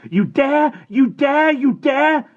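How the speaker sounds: through clenched teeth, high and loud; ground out. A narrator's reading. Boy pinning his friend down, through clenched teeth. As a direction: grind each word out furiously. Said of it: "he ground out between clenched teeth".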